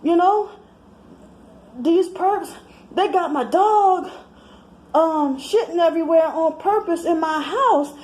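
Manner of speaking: put-on high, animated girlfriend gossip voice; sassy friend voice. A friend on the phone complaining about perps, in a high sassy voice. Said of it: "they might t-, tell me".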